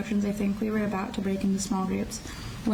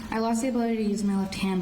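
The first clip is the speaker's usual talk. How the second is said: ordinary delivery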